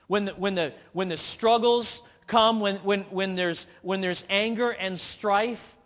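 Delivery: rising fervent sermon voice; preaching at full force. Acted. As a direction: drive the point home from the pulpit.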